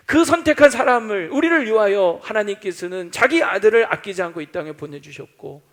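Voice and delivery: ordinary delivery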